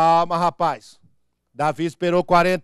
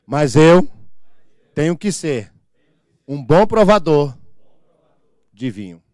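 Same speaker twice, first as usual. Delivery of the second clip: call and response; 4 semitones lower, 7 dB louder, slower than usual. Shown as a demonstration. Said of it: "Repitam após mim".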